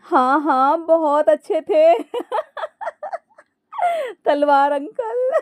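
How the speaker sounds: helpless high-pitched laughter through the words; laughing. A narrator's reading. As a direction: agree while cracking up, drawing words out. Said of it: "फिर वो हंसते हुए ही बोली". (हंसते-हंसते, ऊँची आवाज़ में)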